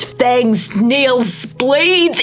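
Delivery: plain reading voice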